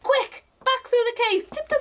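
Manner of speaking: ordinary delivery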